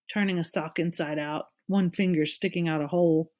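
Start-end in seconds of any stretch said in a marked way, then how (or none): none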